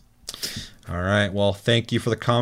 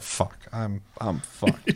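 quiet despair; 6 dB quieter, much slower than usual. Retold or imitated. Realizing he's caught.

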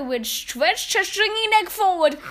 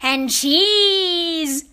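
mild witch shout; 3 semitones higher, 6 dB louder than usual. A narrator's reading. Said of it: "shouted the Grand High Witch".

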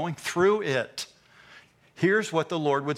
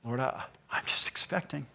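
whispered, hushed prayer of expectation; praying in a whisper, still hopeful; 7 dB quieter than usual. Retold or imitated. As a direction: breathe the prayer out softly. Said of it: "a doubt which desires".

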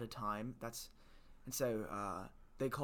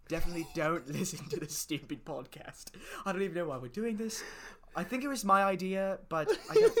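giggly mock self-sabotage, rising and louder; laughing and getting louder while telling listeners not to listen; laughing while talking; 5 semitones higher, 6 dB louder than usual. Real, not acted. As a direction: laugh through the words, getting higher and louder. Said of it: "As you can tell, we're both very good at marketing".

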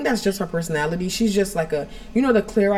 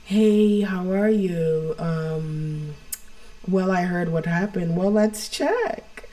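emotionless doctor; much slower than usual. Retold or imitated. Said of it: "very emotionless".

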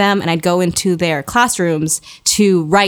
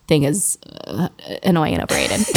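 a touch lower and softer, lightly voiced Kira; Kira's voice; 6 semitones lower, 5 dB quieter than usual. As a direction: slip into Kira's calm low tone for a moment. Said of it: "I, as Kira".

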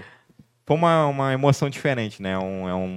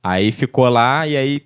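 normal talking voice

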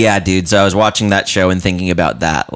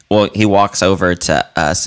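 ordinary talk

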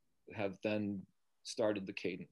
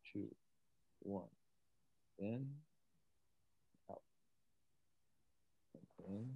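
guided breathing. Shown as a demonstration. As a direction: talk them gently through each breath. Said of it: "Demonstrate cadence breathing for us".

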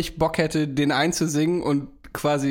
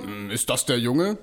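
put-on lower, slower, drawn-out old doctor; 6 semitones lower, 3 dB quieter than usual. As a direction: hum it, slow and low, like a dry old doctor. Voicing Helge's doctor character.

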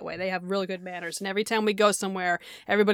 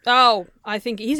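ordinary talk

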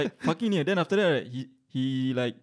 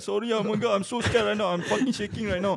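mock fear; 6 semitones higher than usual. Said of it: "I just troll him".

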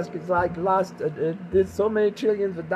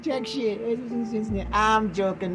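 ordinary talk

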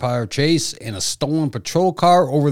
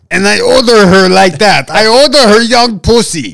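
loud, higher mock Russian buyer; Russian accent; 6 semitones higher, 7 dB louder than usual. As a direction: bellow it in a fake Russian accent. Voicing Yuri, loud.

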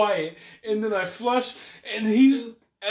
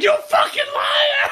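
much higher, slow, shouted accusation; mock furious yell; 5 dB louder, slower than usual. Man stuck in the stall yells after him, mock furious. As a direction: shriek the insult, hammed-up and laughing. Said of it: "He's like".

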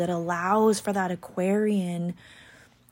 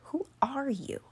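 mock baffled reply; 7 semitones higher, 4 dB quieter than usual. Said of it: "People are like".

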